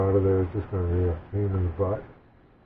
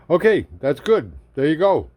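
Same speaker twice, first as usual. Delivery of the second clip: delighted; 7 semitones higher, 7 dB louder than usual. Real, not acted. Delighted tasting.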